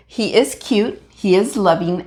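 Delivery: ordinary talk, nothing marked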